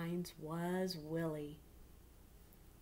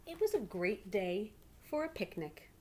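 put-on lively read-aloud to children; story narration. A narrator's reading. Teacher reading a picture book to her class, lively.

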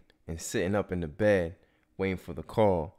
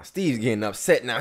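ordinary talk, nothing marked

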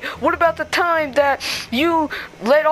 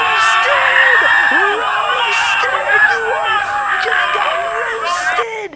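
high, loud taunting yell; gloating shout; 10 semitones higher, 5 dB louder, much slower than usual. Acted. Spider Chicken taunting Spider-Man after the insult, high gloating shout. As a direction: crow over the burn.

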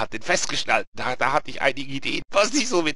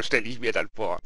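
ordinary delivery

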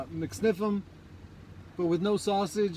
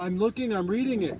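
ordinary delivery

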